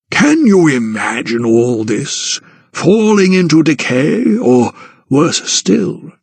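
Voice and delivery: a touch of foreboding, slow and drawn out; mildly ominous warning from an old guardian; understated dread, slowed down. Acted. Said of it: "a note of terrible foreboding in his voice".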